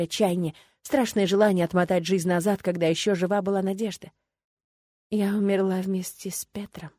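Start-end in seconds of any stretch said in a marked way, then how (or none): 5.1-6.9 whispered, hollow despair. Acted. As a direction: breathe it out, barely voiced. Rose's inner despair thought.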